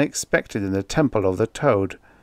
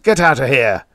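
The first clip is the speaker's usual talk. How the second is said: loud, raised furious order; screamed; 4 semitones higher, 7 dB louder than usual. A narrator's reading. Tusman throws the servant out, screaming. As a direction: shout the servant out. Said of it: "he screamed".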